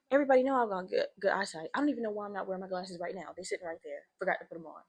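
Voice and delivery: usual speaking voice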